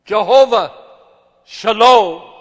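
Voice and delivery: crying out a name of God at full voice; loud cry. Acted. Preacher voicing David's cry, loud call.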